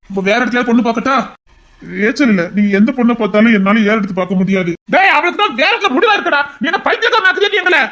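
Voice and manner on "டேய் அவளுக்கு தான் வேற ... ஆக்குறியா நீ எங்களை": higher-pitched, incredulous rebuke with a bitter laugh; exasperated outburst. Acted. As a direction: throw up your hands at the son. Exasperated father argues.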